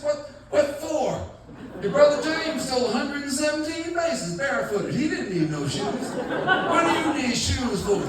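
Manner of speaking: hammed-up dad heckling his kid at football. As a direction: jeer at your son from the stands.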